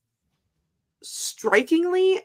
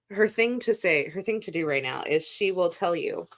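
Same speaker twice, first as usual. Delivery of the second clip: usual speaking voice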